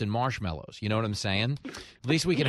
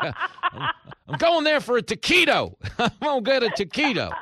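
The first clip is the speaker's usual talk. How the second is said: put-on much higher, dopey old man voice, laughing through it; mock Biden voice; 12 semitones higher, 5 dB louder than usual. Host mocking Joe Biden, in a much higher dopey voice. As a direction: play a confused old man chasing a taquito, pitched way up. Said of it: "he's like".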